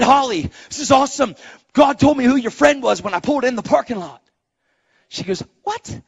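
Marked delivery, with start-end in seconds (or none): 0.0-4.2 high, loud, thrilled retelling; excited announcement. Retold or imitated. Nephew runs in to tell his aunt, excited. 5.6-6.0 imitated baffled "what"; startled question. Aunt hears the news, startled.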